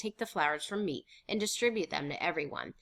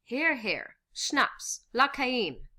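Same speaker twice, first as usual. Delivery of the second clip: higher, cheery toast; celebratory toast; 6 semitones higher, 5 dB louder, much slower than usual. Acted. Toasting the invasion with cognac, celebratory. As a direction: offer the drink as a celebration.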